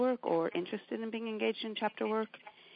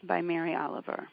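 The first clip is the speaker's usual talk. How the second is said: louder, intense poetry recital; poem reading; 4 dB louder than usual. Acted. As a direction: read the poem with building conviction. Reads Mary Oliver poem.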